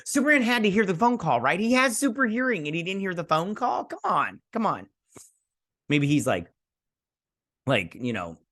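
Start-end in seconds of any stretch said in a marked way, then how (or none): 0.1-4.9 high-pitched, exasperated disbelief; pitched-up mock exasperation; incredulous complaint.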